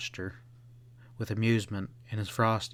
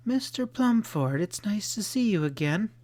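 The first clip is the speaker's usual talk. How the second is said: bright, smiling welcome, pitched up high; smiling greeting; 9 semitones higher than usual. A narrator's reading. Girl smiles greeting Mister Plumford on the stairs. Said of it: "she smiled".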